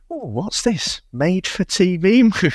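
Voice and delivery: high-pitched, mock-delighted curiosity; ooh, what have we here; intrigued discovery. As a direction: act out spotting an irresistible find. Acting out his delighted discovery.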